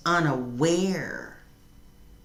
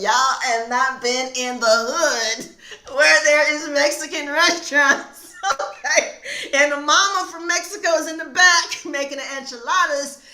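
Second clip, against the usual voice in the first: laughing comeback; 9 semitones higher, 7 dB louder than usual. Retold or imitated.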